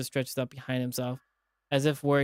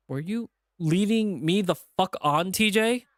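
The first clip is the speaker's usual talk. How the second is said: louder, higher, slow demand; angry accusation; 7 semitones higher, 5 dB louder than usual. Acted. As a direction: drag it out through anger.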